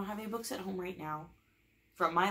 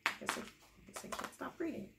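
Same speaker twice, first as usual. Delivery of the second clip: whispered, higher, resigned; mock defeated whisper; 7 semitones higher, 6 dB quieter than usual. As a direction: give in under your breath. Defeated aside.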